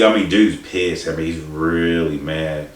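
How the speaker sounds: ordinary talk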